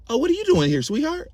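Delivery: high, sweet surprised greeting; sugary mom voice. Retold or imitated. Sweet mom surprised to see him here. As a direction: coo it sweetly.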